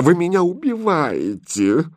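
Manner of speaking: high, wailing lament; groaning. Acted. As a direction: moan in theatrical despair. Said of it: "простонала гофмистерина". (со стоном, причитая)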